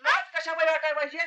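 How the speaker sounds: high, quick servant voice; simpleton servant. Pretend. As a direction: answer back innocently, pitched high. Servant act answers the scolding. (भोळ्या नोकराचा उंच, भराभर आवाज)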